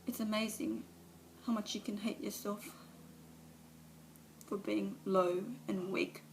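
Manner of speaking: Alice breaking down before the meeting; tearful confession. Acted. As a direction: let the shame and grief spill over. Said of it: "a monologue from one of, of her movies".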